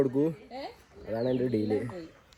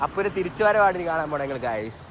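upbeat vlog outro; 6 semitones higher, 5 dB louder than usual. Real, not acted.

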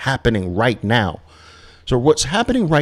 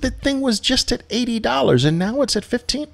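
pitched way up in disbelief; incredulous retelling; faster than usual. Retold or imitated. Trader retelling the crash to a friend, pitched up in disbelief. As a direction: tell it like you can't believe the price swing. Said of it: "I said".

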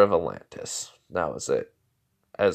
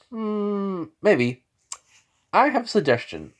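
playful Mori; 10 semitones higher than usual. Pretend. Mori toys with Zabuza.

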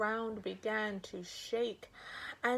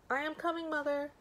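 put-on child's voice; 8 semitones higher, faster than usual. Boy answers his mother.

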